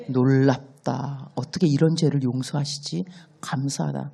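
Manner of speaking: very slow, hushed wonder; awed gratitude. Acted. Believer quietly amazed at God's forgiveness, hushed awe. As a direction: marvel at it quietly, almost to yourself.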